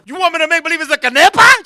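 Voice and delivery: fast loud disbelief; loud, fast, disbelieving retort; incredulous. Retold or imitated. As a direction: fire it back in disbelief. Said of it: "incredulous retort".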